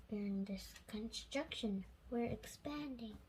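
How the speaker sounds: soft unvoiced whisper. A narrator's reading. Whispered aside under the breath, softly.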